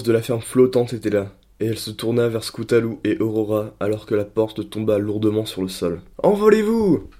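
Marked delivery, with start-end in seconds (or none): 6.2-7.1 high, pained cry. Acted. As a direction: call out a warning through pain. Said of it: "d'une voix douloureuse".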